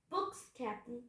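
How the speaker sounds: plain reading voice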